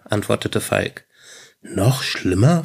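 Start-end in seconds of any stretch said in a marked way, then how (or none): 1.6-2.6 slow, mildly worried question; understated worry, taking it slowly. A narrator's reading. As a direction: ask with a touch of concern, drawn out. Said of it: "fragte Tom besorgt".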